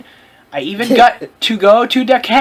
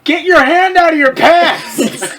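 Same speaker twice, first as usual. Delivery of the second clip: mock scold; 6 semitones higher, 3 dB louder, slower than usual.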